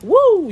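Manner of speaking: high loud mock woo; exasperated woo. Parent imitating being frazzled by kids, high loud woo. Kids acting up in full moon.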